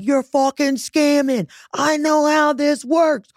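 high, slow, accusing sneer; mock accusation. Accusing the doctor's office caller, slow high sneer. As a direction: drag out the accusation.

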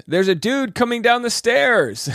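high-pitched imitation of a yell; mock yell. Podcast host imitates lady announcing a new arrival, mock yell. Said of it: "A lady yells".

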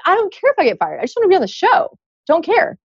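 high-pitched inner voice; mock indifference. Employee privately only wants the show, mock-carefree. Acting out her inner thoughts.

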